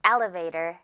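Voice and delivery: "elevator" with a punched EL and low tail; first-syllable stress. Shown as a demonstration. Teacher models the example sentence, first-syllable stress. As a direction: lean on EL in "elevator", let the rest fall. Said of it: "L 부분에 강하게".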